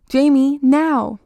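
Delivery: exasperated mother yelling upstairs; impatient call. A narrator's reading.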